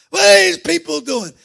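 high, loud sneering mock question; mocking scoffer. Scoffers mocking the young soul winners, in a high loud sneer. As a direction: sneer it like a scornful onlooker.

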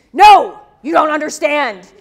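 loud, impatient imitation of an annoyed professor; exasperated retort. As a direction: snap it back at the pest in the audience. Retold exasperated professor snaps at questioner.

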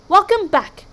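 high, loud welcome; hearty welcome. A narrator's reading. Viking greeting the returned warriors, heartily. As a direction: call it out heartily.

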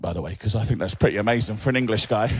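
ordinary talk

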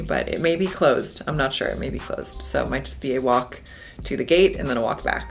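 ordinary talk

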